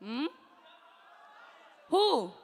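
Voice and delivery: normal talking voice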